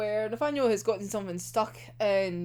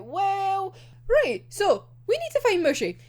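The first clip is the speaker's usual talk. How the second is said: much higher, eager planning voice; voicing Ashley's plan; 9 semitones higher than usual. Retelling Ashley's plan to the listener, much higher and eager. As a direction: play the hopeful detective thinking aloud. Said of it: "'cause it's like".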